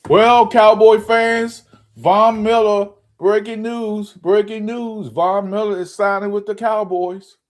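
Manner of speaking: high-pitched, hyped-up news bulletin; mock breaking news. Host breaking signing news to Cowboy fans, mock news bulletin. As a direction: announce it like a big news flash.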